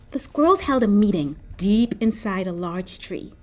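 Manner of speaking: plain reading voice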